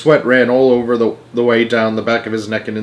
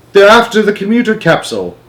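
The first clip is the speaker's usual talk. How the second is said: high-pitched exclamation; alarmed cry; 9 semitones higher, 4 dB louder than usual. A narrator's reading. Boy watching Knids chase the capsule, crying out. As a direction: call out the danger. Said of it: "cried Charlie".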